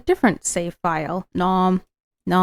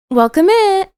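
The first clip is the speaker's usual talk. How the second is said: bright high shop greeting, raised pitch; cheery shopkeeper; 9 semitones higher than usual. Acted. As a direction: sing out a welcome to the customer.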